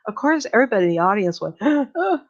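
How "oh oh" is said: shocked gasp. Retold or imitated. Audience gasping in shock.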